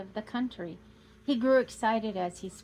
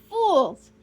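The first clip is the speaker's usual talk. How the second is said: higher and louder second cry of fools; louder scornful shout; 9 semitones higher, 12 dB louder than usual. Acted. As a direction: hurl it out even harder, full scorn. Count repeats it louder.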